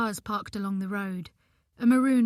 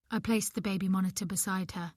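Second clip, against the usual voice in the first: usual speaking voice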